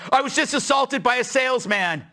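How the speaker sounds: high-pitched mock crime victim; mock distressed caller. Caller reporting an assault to 911, mock distressed.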